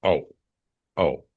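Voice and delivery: low, slow stunned oh; taken aback, drawn-out oh's. Retold or imitated. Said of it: "And I was just like".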